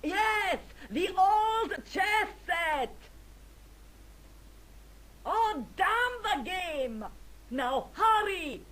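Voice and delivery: high, loud imperious calling; shouted orders. Acted. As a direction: call out urgent orders to a distant servant. Countess calls orders to servant.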